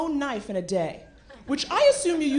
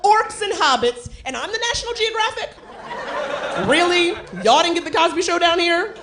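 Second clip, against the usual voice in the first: much higher, louder incredulous rant, laughing; outraged high rant; 6 semitones higher, 3 dB louder than usual. Acted. Ranting at New Zealand locals, high and outraged. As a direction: squeal your disbelief at them.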